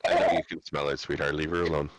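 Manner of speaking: low, gentle soothing; soothing pet talk. Real, not acted.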